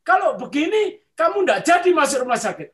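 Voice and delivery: high, loud retold astonishment; startled fortune-teller. Fortune-teller revising a prediction for a client, astonished. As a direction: imitate a baffled fortune-teller revising the verdict. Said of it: "bilang begini".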